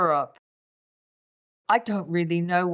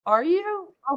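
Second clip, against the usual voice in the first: surprised; 10 semitones higher than usual. Real, not acted. Teasing surprise at his claim.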